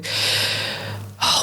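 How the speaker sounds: long breathy sigh. Real, not acted. Trying to recall where she went, sighing. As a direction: sigh while hunting for the memory. Searching memory for a place name.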